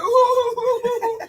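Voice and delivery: high wailing mock sob; mock crying wail. Mocking a man crying in the bathroom, wailing. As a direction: wail like a crying little kid. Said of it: "the bathroom crying like".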